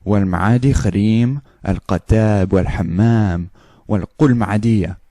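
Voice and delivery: fast Arabic-sounding gibberish; fake Arabic. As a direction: rattle off fake Arabic quickly. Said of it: "now we do the Arabic".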